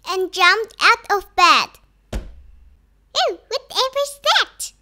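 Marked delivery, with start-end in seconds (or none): 3.1-4.7 high-pitched puzzled alarm; storybook witch taken by surprise; startled question. A narrator's reading. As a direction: ask in high, startled wonder. Winnie startled out of bed.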